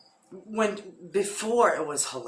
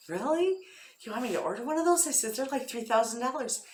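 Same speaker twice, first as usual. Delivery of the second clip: Really? jumps much higher in a rising squeak; retold shock, pitched way up and rushed; astonished at the three thousand dollar price; incredulous really; 8 semitones higher, faster than usual. Retelling reaction to husband's request.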